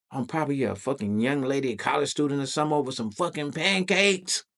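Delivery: acted-out exasperated reproach; incredulous self-scolding. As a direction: build to an appalled high finish.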